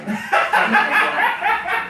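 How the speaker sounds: high cackling laugh; loud laugh burst. Real, not acted. Bursts out laughing mid-story.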